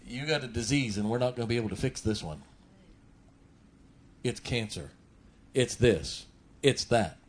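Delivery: doctor's grave news. Retold or imitated. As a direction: break the news gently and fast. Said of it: "he looks at you and says".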